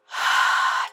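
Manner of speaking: loud exhale without voice; deep releasing sigh. Shown as a demonstration. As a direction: breathe out audibly to lead the listeners. Models a deep releasing breath.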